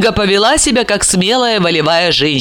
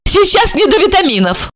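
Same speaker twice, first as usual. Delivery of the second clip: high, irritated stepmother; angry; 12 semitones higher than usual. A narrator's reading. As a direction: cut him short, high and cross. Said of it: "рассердилась Ольга".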